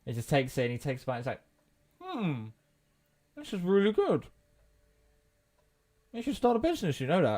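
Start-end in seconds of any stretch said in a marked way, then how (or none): 2.0-7.4 talking through a mouthful of sandwich; mouth full. Acted. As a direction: chew and speak with mouth full. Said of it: "I'm talking with my mouth full".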